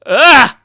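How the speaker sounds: loud, high startled yelp; startled scream. Acted. Character running into Mister Gavin, startled cry. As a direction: yelp loudly, suddenly startled.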